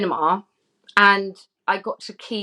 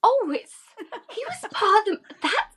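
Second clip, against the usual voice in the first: sudden realisation; 12 semitones higher, slower than usual. Retold or imitated. Realises who he was at screening.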